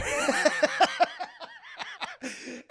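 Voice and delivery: high burst of laughter; real laughter. Real, not acted. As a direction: crack up at your own story. Laughing at own retelling.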